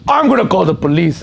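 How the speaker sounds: high, fast mock outburst; retold indignant yelp; imitating uncle losing it. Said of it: "And he lost it".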